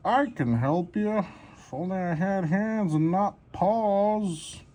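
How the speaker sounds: high, slow pet voice; dog voice. Pretend. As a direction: voice the dog wanting to help. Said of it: "He's like".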